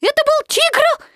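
very high, loud, alarmed squeak; high-pitched little animal in alarm; anxious Piglet. A narrator's reading. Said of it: "с тревогой сказал Пятачок".

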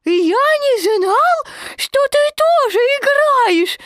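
put-on high, loud, drawn-out child; a small joey worried his stick is lost; squeaky fretful kid; little Roo voice. A narrator's reading. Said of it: "сказал Крошка Ру".

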